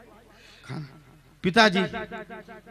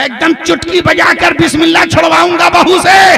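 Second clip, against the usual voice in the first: higher, louder cocky brag; gleeful boast; 6 semitones higher, 3 dB louder than usual. Acted. Father-in-law boasts to his wife, gleefully bragging. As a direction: crow over the plan with a snap. Old man boasts, dancing.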